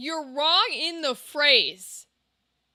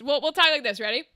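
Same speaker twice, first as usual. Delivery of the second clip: ordinary talk, nothing marked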